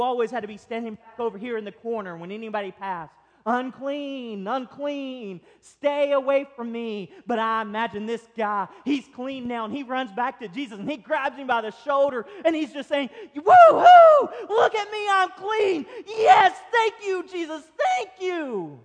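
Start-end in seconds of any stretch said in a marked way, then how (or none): 3.4-7.1 raised, drawn-out calling voice; leper's warning cry. Acted. Outcast leper warning anybody who passed, calling out. 13.3-18.8 very high, full-volume jubilant yell; ecstatic shouting. Acted. Healed leper praising Jesus, ecstatic shout. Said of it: "He's full of excitement".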